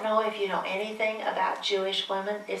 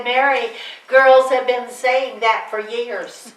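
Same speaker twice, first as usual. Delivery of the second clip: dry dismissal; 3 semitones higher, 7 dB louder, slower than usual. Retold or imitated. Mother dismissing angel story.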